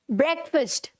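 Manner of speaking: ब्रेकफास्ट said "BREK-fust"; short vowels in both halves of ब्रेकफास्ट; correct pronunciation. Shown as a demonstration. Teacher modelling for students, ब्रेकफास्ट as "BREK-fust". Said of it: "जैसे ये लिखा है ना".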